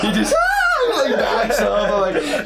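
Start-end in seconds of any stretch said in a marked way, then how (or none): 0.4-1.0 imitating a friend's piercing, very high shriek, drawn out slowly; whistle-tone scream. Roommate sees the snake twitch: high shriek. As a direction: squeal way up high like a startled roommate. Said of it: "That dude just screams in a pitch that's, like, whistle tone".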